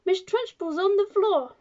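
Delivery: very high, loud schoolboy announcement; screaming. A narrator's reading. Wilfred tells class headmistress fell, screaming. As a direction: shriek sensational news to the room.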